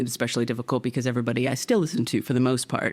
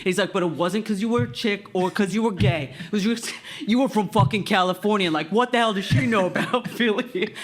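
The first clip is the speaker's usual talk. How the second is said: Philly guy impression; 7 semitones higher than usual. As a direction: do a brash Philly fan. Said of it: "I'm just replicating what he delivered".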